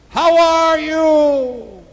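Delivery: long, high call into the wilderness; screamed out. Acted. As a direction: holler it out, stretching every word. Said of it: "He screamed out".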